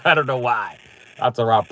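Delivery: ordinary talk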